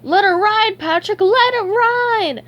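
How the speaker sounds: high, loud, drawn-out egging on; frenzied high cartoon yell; wild urging. A narrator's reading. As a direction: shout it like a reckless gambler. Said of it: "had wildly urged".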